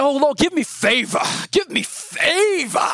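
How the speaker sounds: high, slow pleading prayer; whiny prayer voice. Retold or imitated. Mocking his own selfish prayers to God in a high, slow pleading voice. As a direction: beg God for favor in a high, drawn-out whine. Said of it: "I don't know why I'm using that voice".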